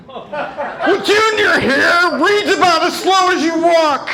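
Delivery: high, loud mocking shout; hammed-up cranky father; dad jab. Dad insulting his son's reading, a loud high mock shout.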